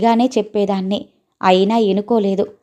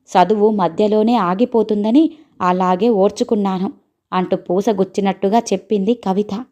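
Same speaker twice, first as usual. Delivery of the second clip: ordinary delivery, nothing marked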